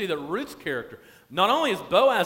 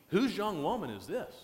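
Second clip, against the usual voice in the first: lilting, interested question rising at the end; "this" lifted into an intrigued question; curious inflection; 2 semitones higher, 5 dB quieter than usual. Acted. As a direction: ask it with intrigued, swooping inflection. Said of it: "he probably used the inflection in his voice".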